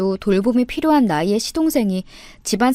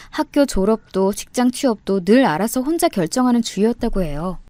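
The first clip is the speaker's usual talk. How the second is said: ordinary delivery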